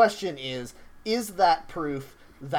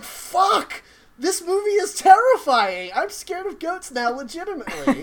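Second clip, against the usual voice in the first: high, loud frightened yell; terrified outburst; 13 semitones higher, 6 dB louder than usual. Retold or imitated. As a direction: shriek it out in horror. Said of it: "I was like".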